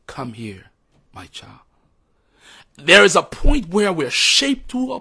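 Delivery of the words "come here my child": much softer, lower, hushed call; barely above a breath, tender; God calling gently in a still small voice. Retold or imitated. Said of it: "God is speaking in a still small voice".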